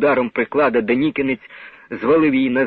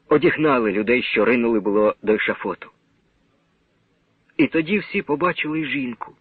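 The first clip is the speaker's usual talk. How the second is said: ordinary delivery, nothing marked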